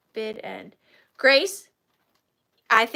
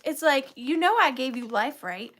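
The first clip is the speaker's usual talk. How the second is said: ordinary delivery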